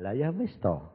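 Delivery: normal talking voice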